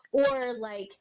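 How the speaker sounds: ordinary talk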